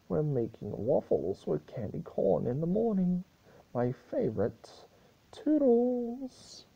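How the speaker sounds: high, loud, playful farewell; squeaky, giddy "Toodles!" leaving; Discord's cheery goodbye. Acted. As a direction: sing out the exit like a showman.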